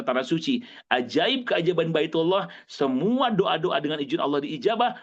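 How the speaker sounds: ordinary talk, nothing marked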